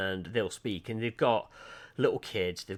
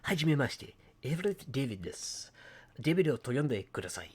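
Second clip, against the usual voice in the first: "Hajime maste" with Japanese vowels, a bit higher; Japanese-style pronunciation; 4 semitones higher than usual. Shown as a demonstration. Contrasting take for viewers, Japanese-style pronunciation. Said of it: "rather than going".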